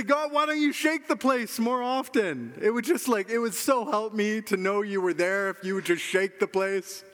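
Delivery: high-pitched, half-joking plea; mock pleading. Preacher acts out asking God to shake things, mock pleading.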